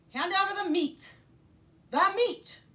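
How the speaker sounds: berro alto e agudo pela carne; piratas rugindo. Acted. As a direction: exija a carne aos gritos. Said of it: "we all roared".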